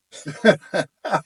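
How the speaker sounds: high loud burst of laughter; real laugh. Real, not acted. Laughing at how little he knew about the draft. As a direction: laugh hard at yourself. Laughs at his naive question.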